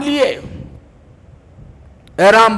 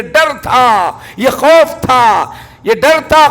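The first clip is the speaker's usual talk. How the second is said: rising fervour; slower than usual. Acted. Preacher rising about fear.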